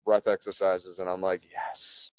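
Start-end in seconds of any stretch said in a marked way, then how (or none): 1.5-2.1 whispered yes of relief; quiet yes. Retold or imitated. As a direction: breathe it out silently, pleased. Quiet relief hearing son breathe.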